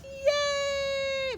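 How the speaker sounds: high excited crowd cheer. A narrator's reading. Crowd cheers as Big Bark is carried off, high cheer. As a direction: whoop with joy. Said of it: "the crowd cheered".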